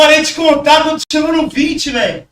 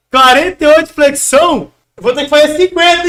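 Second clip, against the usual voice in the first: ordinary talk, nothing marked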